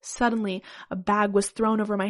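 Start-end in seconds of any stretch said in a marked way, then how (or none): none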